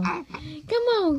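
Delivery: normal talking voice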